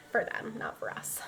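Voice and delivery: unvoiced conspiratorial whisper; hushed joking aside. Real, not acted. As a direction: whisper the joke like a secret. Whispered aside about the game.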